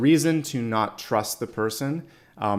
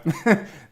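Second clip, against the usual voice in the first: light laugh; 11 semitones higher, 5 dB louder than usual. Real, not acted. Laugh while voicing self-talk.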